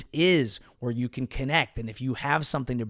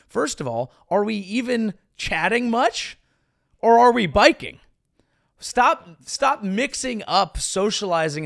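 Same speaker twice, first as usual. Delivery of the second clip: higher, louder, exasperated disbelief; mock exasperated rant; incredulous; 7 semitones higher, 4 dB louder than usual. Real, not acted. Mock outrage at a bike-ride chat.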